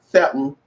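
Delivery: "Seven" said low, squashed to "se'm"; Southern pronunciation. Shown as a demonstration. Repeating his dialect word, "Seven" slurred to "sebm". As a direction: drop the v in "Seven", ending on m. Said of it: "That's what I said, not seven".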